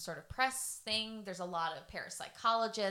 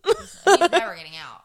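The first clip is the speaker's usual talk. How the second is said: loud, laughing through the words; 16 dB louder than usual. Real, not acted. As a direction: burst out laughing mid-line. Laughing fit mid-sentence.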